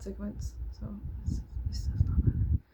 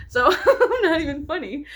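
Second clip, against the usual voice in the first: laughing through the words, pitched up; 8 semitones higher, 9 dB louder than usual. Real, not acted. Vlogger laughing at herself while she talks. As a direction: giggle it out.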